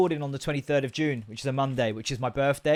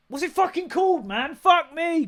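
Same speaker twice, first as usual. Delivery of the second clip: exasperated, much higher, struggling to remember; frustrated; 14 semitones higher, 5 dB louder than usual. Real, not acted. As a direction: groan at a forgotten word. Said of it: "Come on, brain".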